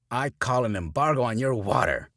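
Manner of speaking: loud proclamation yelled; yelling preacher. A narrator's reading. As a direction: decree it at full volume. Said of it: "Preacher Carey yells".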